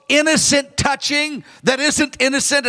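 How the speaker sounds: normal talking voice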